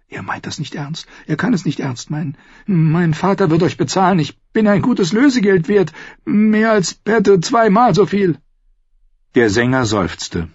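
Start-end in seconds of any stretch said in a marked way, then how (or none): 0.1-8.4 nervous lord pleading; frightened bargaining. Acted. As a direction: talk himself out of hanging. Merritt disbelieving, bargaining.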